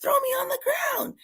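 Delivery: put-on high, crying child's protest; imitating a sobbing boy yelling at his teacher; tearful retold yell. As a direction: cry it out like the son near tears. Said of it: "he's getting upset".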